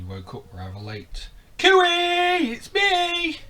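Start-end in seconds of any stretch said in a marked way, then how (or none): 1.6-3.4 high, loud little fish voice calling to friends; calling out as Stanley. Acted. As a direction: sing out a much higher cheery call. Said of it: "he called".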